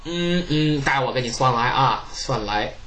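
low unhurried fortune-teller voice; old fortune teller. Acted. Jiang Ziya voiced.

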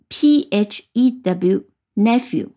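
P H E W, nephew, letter by letter, slowly; slow spelling-out. Shown as a demonstration. Teacher to students, spelling nephew slowly. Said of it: "要记住".

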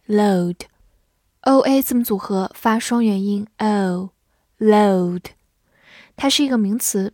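normal talking voice

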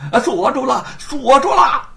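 high, loud cry of triumph; excited yell. A narrator's reading. Constable thinking he has chained the monkey: excited yell. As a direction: shout it like you've caught him. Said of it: "高声叫嚷".

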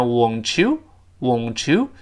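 "won't you" said as "wonchoo"; linked ch sound. Shown as a demonstration. Teacher giving a second example, "won't you" linked with ch. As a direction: slowly join "won't you" with a ch. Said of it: "又会变成ch这个声音".

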